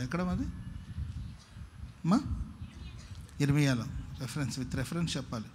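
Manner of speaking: ordinary talk, nothing marked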